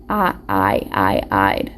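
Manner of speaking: stretched long "I" said as in ride; long I sound. Shown as a demonstration. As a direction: hold the long vowel "I" like "ride". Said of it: "we can hear it in ride".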